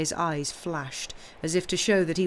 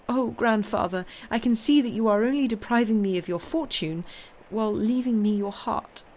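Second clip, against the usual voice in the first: higher, moved outburst of affection; heartfelt exclamation; 5 semitones higher, 4 dB louder than usual. A narrator's reading. Granddaughter realising grandfather still loves her, heartfelt exclamation. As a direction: exclaim it with feeling, pitch lifted. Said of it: "such an expression of tenderness that she exclaimed".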